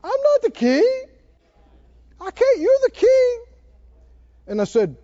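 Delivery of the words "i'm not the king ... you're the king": high blubbering mock whine; fake-sob it, pitched way up; hammed-up crybaby protest; mock crying. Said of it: "started crying and said".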